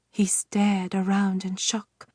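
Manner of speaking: plain reading voice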